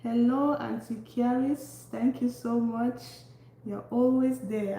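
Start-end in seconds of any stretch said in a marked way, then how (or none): none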